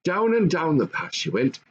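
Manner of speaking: usual speaking voice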